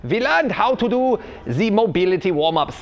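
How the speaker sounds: hammed-up German fitness coach explaining; the v for w, the j sounds, stern but cheery; fake German accent. Coach explaining warm-up theory to viewers, fake German accent.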